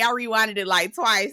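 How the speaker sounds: usual speaking voice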